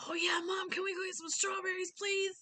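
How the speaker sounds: put-on high, loud begging kid voice; eager child. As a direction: plead excitedly like a kid. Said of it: "the child will either be like".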